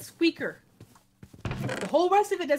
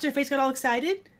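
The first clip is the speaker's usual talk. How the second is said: usual speaking voice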